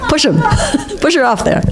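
can't stop laughing while saying it. Real, not acted. Joking, laughing at the story.

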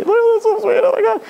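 hammed-up teary girlish gush; gushing girl impression. Girls in tears at an old couple, mocked high-pitched gushing. Mocking girls tearful at sweet sights.

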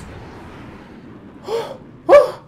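big voiceless huffs of air; sharp breaths. Real, not acted. Gamer trying to stay calm mid-match, sharp breaths. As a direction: blow out hard to calm down.

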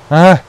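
sharp loud yelp of pain; pained yell. Real, not acted. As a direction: cry out as you get hit. Gets hit in game.

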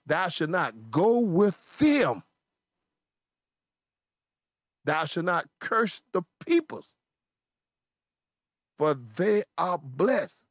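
slow, stretched words with long pauses; solemn scripture command. A narrator's reading. Preacher reading God's words to Balaam, slow and weighty. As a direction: proclaim it slowly, letting each phrase land.

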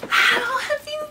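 high, slow, loud sing-song greeting; high, slow cartoon gush; cartoon friend. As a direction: gush like an overexcited cartoon pal. Skit character greeting.

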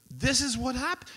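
high-pitched, astonished outburst; amazed discovery. Retold or imitated. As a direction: imitate someone stunned by what he's reading. New reader's astonished discovery, retold.

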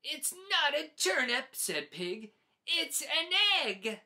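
fed-up little pig digging in; insistent shout. Acted. Pig shouts at Goose it is an egg.

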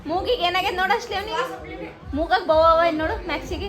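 high, loud sing-song calling. Real, not acted. Mother calling baby Max to look at the camera, high and loud.

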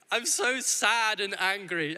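put-on high, slow, sing-song little girl's lament; mock a child's melodramatic cry; hammed-up little girl, sad and angry; child's dramatic wail. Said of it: "she just shouted".

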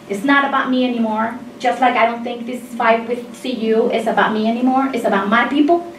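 usual speaking voice

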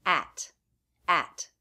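"at" with the bat vowel and a true T; full form of at. Shown as a demonstration. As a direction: say "at" fully, open a and crisp T. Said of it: "it has the a as in bat vowel and the true T".

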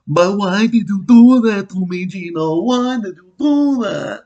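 high, loud mock accent, Count-like; hammed-up accented wail, slow at the end; yelling in an accent. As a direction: badly imitate a woman yelling in a foreign accent. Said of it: "girl yelling in an accent".

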